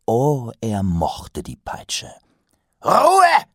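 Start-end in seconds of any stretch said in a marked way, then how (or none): 2.8-3.5 thundering roar, much higher and louder; booming male voice. A narrator's reading. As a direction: thunder it to silence the room. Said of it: "donnerte er".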